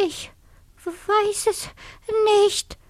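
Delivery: very high, halting mouse squeak; frightened whisper. A narrator's reading. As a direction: break it up timidly, word by word. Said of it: "flüsterte die Haselmaus".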